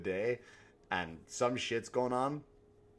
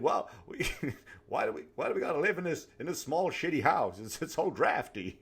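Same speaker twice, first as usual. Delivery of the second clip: whiny family; 5 semitones higher, 6 dB louder than usual. Retold or imitated. Family complaining, voiced.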